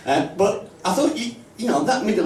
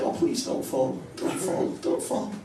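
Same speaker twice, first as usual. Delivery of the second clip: softer whispered pleading; whispering please don't fall under the breath; 3 semitones higher, 8 dB quieter than usual. Retold or imitated. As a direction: whisper it like a nervous prayer. Pleading inner thought for skier.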